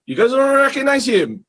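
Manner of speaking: you guys don't recognize him, up high; imitating the guy. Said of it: "he goes".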